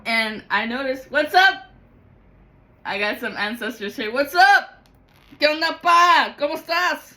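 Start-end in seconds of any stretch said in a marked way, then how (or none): 1.1-1.7 high loud friendly call; excited greeting. Real, not acted. Greeting the ancestors he feels around him, high loud hello. 4.1-4.8 high loud friendly call; excited greeting. Real, not acted. Greeting the ancestors again, high and loud. 5.4-7.1 high, loud friendly hello in Spanish; cheerful Spanish greeting. Real, not acted. Greeting a departed father in Spanish, high and cheerful.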